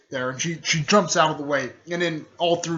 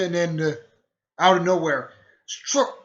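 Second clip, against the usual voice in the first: ordinary delivery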